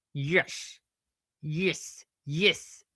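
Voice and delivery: short Australian-accent repetitions; attempted Australian accent. Shown as a demonstration. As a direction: practise one word in Australian. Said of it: "try to do an Australian accent".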